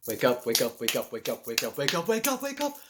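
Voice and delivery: urgent inner voice. Retold or imitated. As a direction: yell at yourself to wake up. Inner voice waking him.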